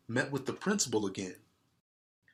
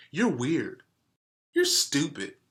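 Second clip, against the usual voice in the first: insult; 6 semitones higher, 6 dB louder than usual. Acted. Kids trading insults.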